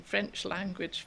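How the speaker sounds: usual speaking voice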